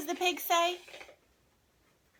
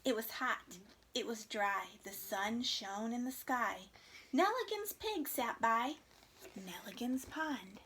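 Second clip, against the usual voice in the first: put-on gentle read-aloud to children; story narration; 3 semitones lower, 4 dB quieter than usual. A narrator's reading. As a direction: read it like a picture book for kids. Teacher reads picture book aloud.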